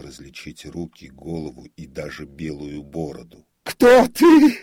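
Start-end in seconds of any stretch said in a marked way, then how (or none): 3.6-4.6 very high, loud desperate shriek; desperate cry. A narrator's reading. Comic repeats challenge to the ghostly figure, desperate shriek. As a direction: scream it out in despair. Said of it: "повторил отчаянным голосом".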